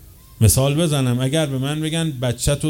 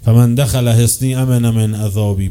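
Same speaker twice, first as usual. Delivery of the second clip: solemn recitation; 5 dB louder, slower than usual. Retold or imitated. Quoting the sacred hadith.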